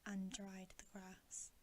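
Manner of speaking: plain reading voice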